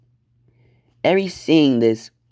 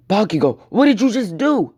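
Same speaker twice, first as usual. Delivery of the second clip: high-pitched, appalled outcry; horrified shock; 9 semitones higher, 3 dB louder than usual. Acted. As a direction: gasp it out, voice shooting up high. Crowd reacts in horror.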